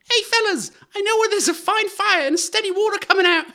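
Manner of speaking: very high, loud squeaky urchin; little scamp. Acted. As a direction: pipe it out like an excited waif. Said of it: "can I hear it as a scamp".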